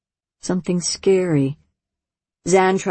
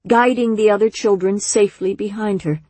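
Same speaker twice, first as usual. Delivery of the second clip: usual speaking voice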